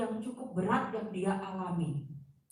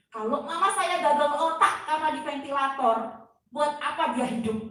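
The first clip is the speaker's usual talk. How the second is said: retold tearful protest; 4 semitones higher, 7 dB louder than usual. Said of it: "saya nangis".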